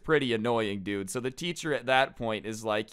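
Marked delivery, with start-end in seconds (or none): none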